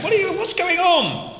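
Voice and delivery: high mock yell of questions; mock shouting. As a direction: play the sailors shouting. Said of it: "Shouting at him".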